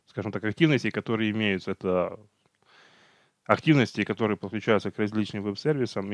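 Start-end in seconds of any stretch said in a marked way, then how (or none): none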